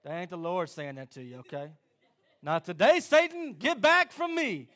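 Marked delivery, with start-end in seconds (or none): 2.4-4.7 loud, slow rebuke of Satan; defiant rebuke. Acted. Believer loudly rebuking Satan under attack. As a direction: shout the devil away, slowly and firmly.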